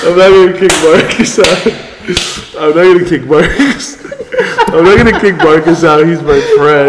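loud, laughing through the words; can barely get it out for laughing; laughing hard. Real, not acted. Laughing while retelling own plea.

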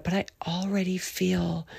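soft unvoiced whisper; hushed confession. Real, not acted. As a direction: share it as a secret.

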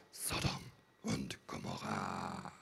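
low, drawn-out doom voice; ominous announcement. Acted. Preacher unveils the scene from Sodom, ominous and drawn out. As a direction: intone it slowly like a horror title. Theatrical announcing of an infamous place.

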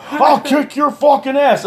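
high-pitched mock rage; imitating a furious man threatening; angry threat. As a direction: yell it high, hammed-up. Insulted man threatens teller.

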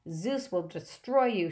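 slow, grim threat; menacing promise. Acted. Mrs Dodds threatens Percy, slow and menacing. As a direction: promise his doom, slowly. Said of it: "she promised".

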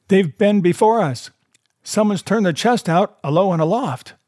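high-pitched report called from above; crying out news. A narrator's reading. Man at the window reports to blind beggar below, calling out. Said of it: "he cried".

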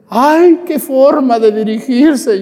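loud, high, slow lament in imitation; mock tearful wail. Offended lady protests to the priest, hammed-up tearful wailing. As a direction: wail in exaggerated hurt at the priest. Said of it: "Y empezó a llorar".

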